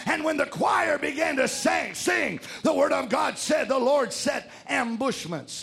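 loud, punched sermon cadence; full-force preaching. Real, not acted. Preacher telling how the choir was sent to battle, full-force preaching. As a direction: drive each phrase home to the congregation.

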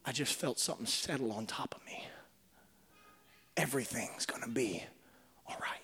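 breathy hush of relief after fear; hushed whisper. Acted. Whispering relief to the congregation. Relieved person quoted in crisis.

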